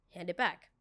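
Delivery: ordinary delivery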